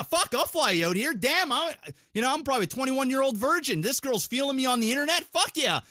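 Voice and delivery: ordinary talk, nothing marked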